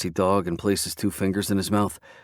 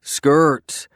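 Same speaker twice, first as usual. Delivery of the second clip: calling out; 7 semitones higher, 6 dB louder than usual. Acted. Friend calling after him.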